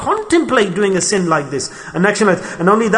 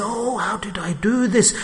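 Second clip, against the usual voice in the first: soft, slow, stunned self-reproach; quietly asking how it happened; dazed regret; 7 dB quieter than usual. Retold or imitated. Man coming to his senses after sin, soft regret. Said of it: "we say".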